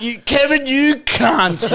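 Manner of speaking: high, loud mock curse at Kevin; high cursing yell. Retelling the food poisoning, cursing Kevin in a high yell. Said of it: "And I thought".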